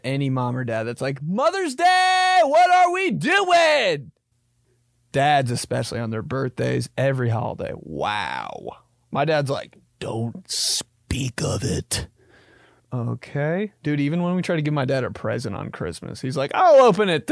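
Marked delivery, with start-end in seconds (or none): 1.2-4.1 much higher, louder, slow drawn-out cheer; imagined parent thrilled about Mother's Day, hammed-up cheer; mock hyped shout. 10.0-12.1 slow, solemn dad voice; dad shutting down birthday talk, slow and ominous; grave dad. Retold or imitated. Dad refusing birthday fuss. 16.5-17.3 higher, louder, flustered dad voice; dad at Christmas dodging the present, flustered. Retold or imitated.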